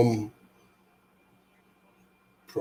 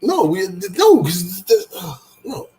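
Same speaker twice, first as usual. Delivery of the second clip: high, slow whiny no; protesting; 8 semitones higher, 5 dB louder, much slower than usual. Real, not acted. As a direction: drag out the no in protest. Partner refuses her suggestion.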